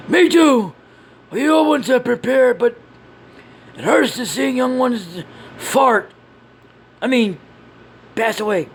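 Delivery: high-pitched earnest character voice; earnest companion. Acted. Companion dreading young deaths before battle, high earnest voice. Second character answers grieving leader.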